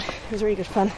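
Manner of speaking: ordinary talk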